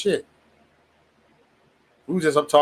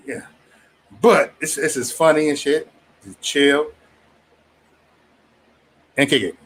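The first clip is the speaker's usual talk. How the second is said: ordinary delivery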